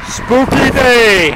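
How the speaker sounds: drawn-out, mock-spooky announcement; spooky horror host. Vlogger showing off the overcast sky, hammed-up spooky. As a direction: stretch it out slowly like a horror host.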